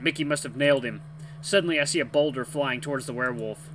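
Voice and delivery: ordinary delivery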